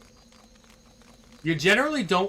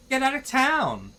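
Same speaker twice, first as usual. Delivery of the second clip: high mock disbelief; Valley Girl shock; surprised Valley Girl; 10 semitones higher than usual. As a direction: gasp it out like a teen. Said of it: "speaking in this freaking accent".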